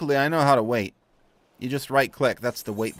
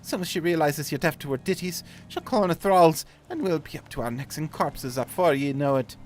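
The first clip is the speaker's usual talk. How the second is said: higher, loud rallying start, easing off; urgent old sailor; 6 semitones higher, 5 dB louder than usual. Acted. Sailor readying lad for the siren's thralls, urgent. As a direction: hurry the lad along in put-on sailor's accent.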